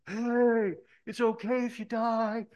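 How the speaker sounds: high, loud holler imitating the friends; friends' teasing shout. Friends calling to a kid just off the cliff, high shouting. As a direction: yell it out like a cheering pal.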